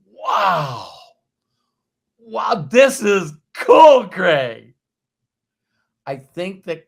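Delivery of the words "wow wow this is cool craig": higher and louder, slow, amazed shout of cool; excited shout. Retold or imitated. Walking on stage, thrilled at what Craig built: excited shout. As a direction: blurt out your amazement at the stage. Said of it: "and I'm like".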